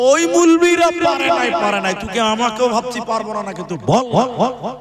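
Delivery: loud, high bluster easing off; bluffing bravado. Retold or imitated. Preacher hiding fear from a small boy, bluffing bravado. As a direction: cover the fear with a big blustering challenge. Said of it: "ছোট ছেলের সামনে তো ভয় খেলে হবে না".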